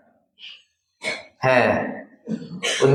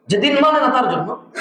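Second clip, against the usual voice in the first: amused repeat; 7 semitones higher than usual. Shown as a demonstration. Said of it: "তুমি তো বলতেছো তুমি মানো".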